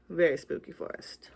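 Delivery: ordinary delivery, nothing marked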